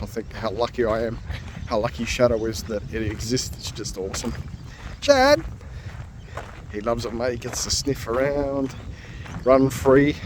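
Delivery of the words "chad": high, loud call across the park. Real, not acted. Calling his dog Shadow from afar.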